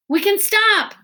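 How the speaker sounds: high, loud call from far up; yelled from ahead. A narrator's reading. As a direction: shout back to the others. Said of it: "Miles yelled from farther up".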